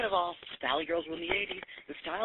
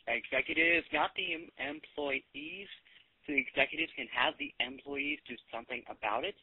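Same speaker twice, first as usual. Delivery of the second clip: normal talking voice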